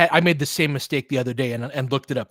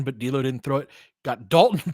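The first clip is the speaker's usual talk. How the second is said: ordinary talk, nothing marked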